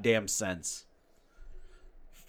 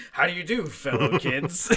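higher, louder meme line; mock fellow kids; 6 semitones higher, 6 dB louder than usual. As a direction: ham up the meme quote. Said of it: "Fellow children".

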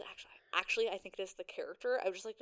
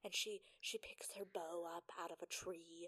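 whisper; 2 semitones lower, 12 dB quieter than usual. Real, not acted. Recalling scene, unsure.

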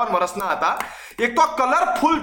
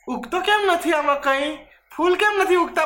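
puzzled lady; 9 semitones higher than usual. Retold or imitated. Mock puzzled lady quote.

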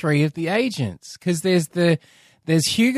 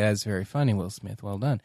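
low, quiet deadpan praise; dry approval; 7 semitones lower, 8 dB quieter than usual. Acted. Audience member responds to Will Smith's joke, dryly. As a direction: toss off faint, understated applause.

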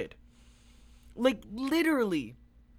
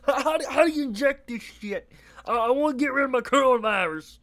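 higher, louder dopey caller voice; mocking caller voice; 6 semitones higher, 6 dB louder than usual. Vlogger mocking a Trump supporter calling the hotline, higher and louder. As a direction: play a clueless caller, pleading. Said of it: "cult members calling in".

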